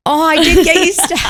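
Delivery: high, loud, laughing triumph; laughing reveal. Retold or imitated. Wife revealing the steak to husband, laughing triumph. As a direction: gloat through laughter at the surprise.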